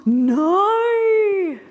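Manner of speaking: high gasp, then a loud cry of no; shocked gasp. Real, not acted. Sudden alarmed outburst.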